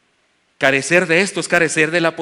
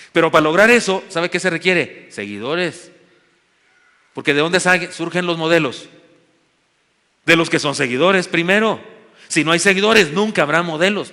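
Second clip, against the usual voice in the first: louder, higher ringing proclamation; preaching at full force; 4 semitones higher, 6 dB louder than usual. Acted. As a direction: drive the point home to the flock. Preaching climax. (predicando con fuerza y en voz más alta)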